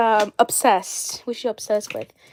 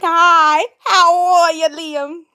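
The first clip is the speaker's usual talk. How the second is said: put-on loud, higher Alabama farm-girl greeting; mock a gushing farm-girl accent; annoying farm-girl accent; 6 semitones higher, 7 dB louder, much slower than usual. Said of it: "With her annoying farm girl accent".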